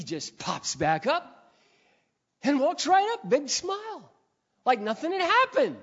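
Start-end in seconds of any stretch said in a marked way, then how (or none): 2.4-5.8 high, loud, impassioned pulpit confession; fervent preacher on a roll; full-force preaching. Real, not acted.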